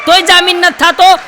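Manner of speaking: high, loud disbelieving question; shocked question. Retold or imitated. Comedian asks the prisoner, shocked and loud. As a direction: ask it in stunned disbelief.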